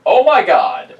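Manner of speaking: loud high shocked cry. Real, not acted. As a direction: blurt it out in shock. Bad dice roll reaction.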